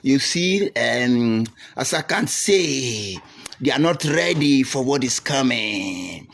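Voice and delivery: lightly voiced slow, measured statesman; a hint of old Ojukwu savouring his words, slow; unhurried mock-grand pronouncement; imitating Ojukwu. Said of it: "Ojukwu lick his mouth".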